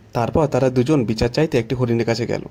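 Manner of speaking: usual speaking voice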